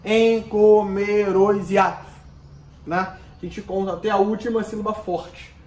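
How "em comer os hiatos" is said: slow, loud, counted-out scansion; syllable by syllable. Shown as a demonstration. Teacher demonstrating the verse's five poetic syllables, syllable by syllable. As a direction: chant "Em comer os hiatos" in five even beats. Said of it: "passa a ter cinco sílabas poéticas".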